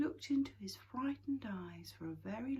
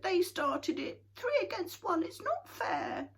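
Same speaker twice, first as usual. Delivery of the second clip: upset; 12 semitones higher, 6 dB louder than usual. A narrator's reading. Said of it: "tired and upset".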